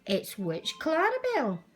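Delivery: plain reading voice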